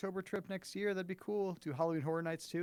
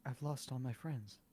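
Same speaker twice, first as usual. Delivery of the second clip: mock sad chat line; 2 semitones lower, 8 dB quieter than usual. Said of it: "Can I get a".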